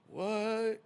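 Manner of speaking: high, baffled what; a lawyer stunned that his client walked free. Retold or imitated. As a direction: act floored by the verdict. Retelling shock at a verdict.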